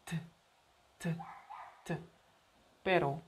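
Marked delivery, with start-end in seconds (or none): 0.0-2.0 crisp T, tongue against the palate; tongue-tip T. Shown as a demonstration. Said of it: "juntar la lengua con el paladar". (T con la lengua contra el paladar)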